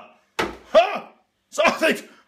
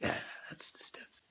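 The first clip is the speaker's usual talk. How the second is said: unvoiced whisper to himself; hushed satisfied whisper; whispered aside; 20 dB quieter than usual. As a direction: sneak a private aside.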